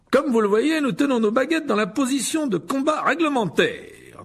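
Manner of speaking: loud, high, theatrical host; pompous showman teacher. A narrator's reading. Lockhart presents his duelling club to the pupils, pompous and projected. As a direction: play the vain professor addressing a crowd.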